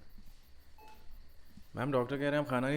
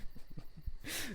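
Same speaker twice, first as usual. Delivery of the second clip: high breathy cackle; 6 dB quieter than usual. Acted. Torturer laughs.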